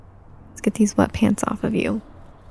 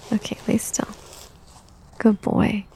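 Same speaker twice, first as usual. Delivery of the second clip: hushed whisper; 6 dB quieter than usual. Acted. Changing the toddler's diaper.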